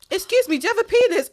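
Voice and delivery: put-on loud child question. Daughter asks stranger loudly.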